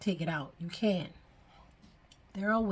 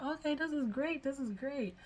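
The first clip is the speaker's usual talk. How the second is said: higher-pitched, lilting approval; retold delight; 7 semitones higher, 3 dB quieter than usual. Recalling reaching six months with her locks, delighted. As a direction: lift the pitch, pleased with the progress.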